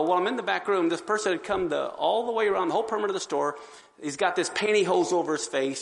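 normal talking voice

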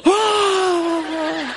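high shouted scream with a laugh; retold scream back. Son caught sneaking in, high laughing scream. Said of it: "the person standing there goes".

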